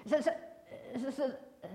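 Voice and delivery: stuck on the s sound, trailing into uh; stuttering. Acted. Sam trying again to answer the teacher, stuck stuttering. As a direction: hiss the s over and over then give up. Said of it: "Sam tried again".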